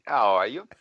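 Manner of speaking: a light London lilt; mock English accent. American answering the Brit in mock English. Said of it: "Was that your English accent?".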